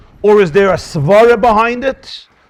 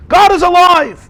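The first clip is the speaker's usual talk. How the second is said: fervent proclamation; 10 semitones higher, 5 dB louder than usual. Real, not acted. Preaching climax.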